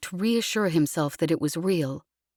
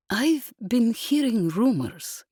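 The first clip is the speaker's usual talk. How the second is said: slow, higher, measured words; 5 semitones higher, slower than usual. A narrator's reading. Yesbel shares rumors with the group, slowly. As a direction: draw each word out carefully. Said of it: "said Yesbel slowly".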